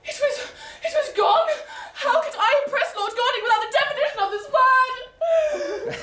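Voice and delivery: high, loud melodramatic despair; hammed-up panic. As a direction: wail it like all is lost. Heroine's dramatic despair.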